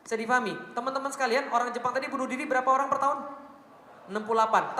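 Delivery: ordinary talk, nothing marked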